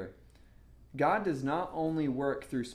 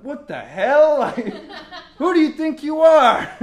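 high, loud indignant yell with laughter; hammed-up disbelief, breaking into laughs; comic outburst at being snubbed; mock outrage; 13 semitones higher, 16 dB louder than usual. Acted indignant reaction to prophet.

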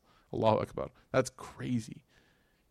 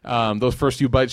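ordinary talk

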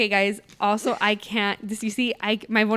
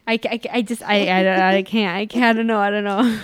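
flustered; 7 dB louder than usual. Real, not acted. Flustered before reading letter.

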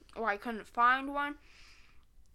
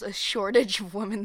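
louder, stressed shortage, skeptical; disapproving echo; 9 dB louder than usual. Retold or imitated. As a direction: repeat it with disapproval. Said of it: "that sentence does not sound correct".

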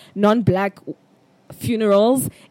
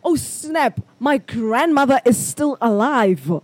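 sudden realization; 3 semitones higher, slower than usual. Retold or imitated. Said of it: "And then I realize".

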